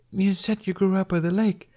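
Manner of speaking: put-on high, drowsy kid voice; a sleepy boy reasoning aloud; lightly sleepy young boy; drowsy little boy. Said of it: "Jamie continued drowsily".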